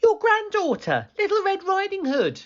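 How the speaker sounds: put-on very high, quick girl voice; little girl. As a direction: announce herself sweetly. Girl's voice.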